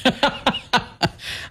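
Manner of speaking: airy little laugh; soft breathy laugh. Real, not acted. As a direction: laugh it off softly. Laughs after fake compliment.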